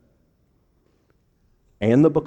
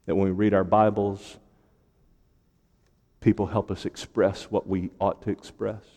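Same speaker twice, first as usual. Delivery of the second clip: ordinary talk, nothing marked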